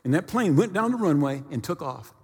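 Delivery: usual speaking voice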